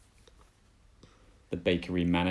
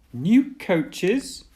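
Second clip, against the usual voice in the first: much higher, loud surprised exclaim; 11 semitones higher, 7 dB louder than usual. Acted. Thomas hearing about new coaches, high excited exclaim. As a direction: burst out in eager surprise. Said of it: "exclaimed Thomas".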